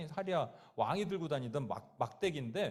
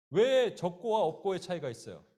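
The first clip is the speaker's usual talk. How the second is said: ordinary delivery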